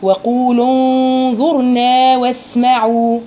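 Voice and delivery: slow chanted recitation; Quran recitation. Shown as a demonstration. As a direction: recite it drawn out and even. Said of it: "السين بتاعت واسمعوا".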